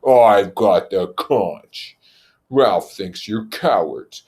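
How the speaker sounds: very slow, loud and deliberate; Jack's slow insistence. Acted. Jack holding the conch and accusing Ralph, slow and loud. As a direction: spell it out to them.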